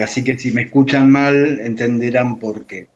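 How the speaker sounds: usual speaking voice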